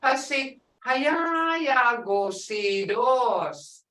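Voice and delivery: Hayayagosidos drawn out very slowly on a held tone; emphatic tone. Shown as a demonstration. Teacher showing how Muskogee says it, drawn out for emphasis. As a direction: stretch the middle of Hayayagosidos long. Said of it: "this is how we say it in Muskogee".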